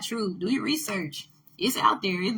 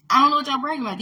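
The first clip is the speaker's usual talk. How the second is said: higher and louder, near a shout; loud exasperated repeat; 5 semitones higher, 7 dB louder, much faster than usual. Real, not acted. Repeating her point to viewers, louder and exasperated. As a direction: throw the line back at them with rising heat.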